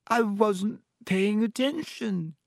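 high, slow, melodramatic wail; dramatic. A narrator's reading. Myrtle answers Harry about that night, dramatic. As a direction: draw it out like a tragic ghost. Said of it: "said Myrtle dramatically".

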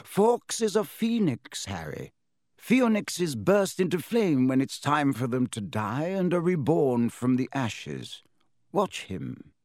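put-on gentle amused old headmaster; high, slow kindly wizard. Dumbledore voiced.

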